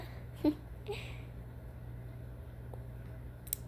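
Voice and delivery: breathy little giggle; soft giggle. Acted. Yandere giggles at own joke.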